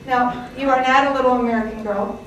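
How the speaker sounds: ordinary talk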